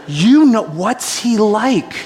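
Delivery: slow, wide-eyed and naive; earnest follow-up. Retold or imitated. Brian innocently asking the guards, slow and naive. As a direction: ask it as if genuinely curious. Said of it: "With sincerity in his eyes".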